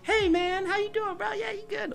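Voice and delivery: very high, over-peppy office hello; mock peppy greeting. Greeting coworkers on a Monday morning, hammed-up peppy. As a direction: chirp it in an exaggerated high voice. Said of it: "you're all peppy and you're going".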